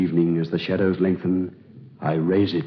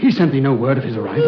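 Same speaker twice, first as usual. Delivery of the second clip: alarm; 6 semitones higher, 4 dB louder, much faster than usual. Acted. Lovers panic at Antony's arrival.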